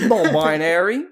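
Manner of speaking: tentative, drawn-out Australian accent, rising like a question. Shown as a demonstration. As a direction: try out a broad Australian accent. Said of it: "say the word non-binary in your most offensive".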